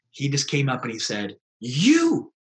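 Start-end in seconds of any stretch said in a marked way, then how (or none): 1.6-2.3 high, loud accusing yell; mock angry shout. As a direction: bark it at him like an angry stranger. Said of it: "I thought he was angry".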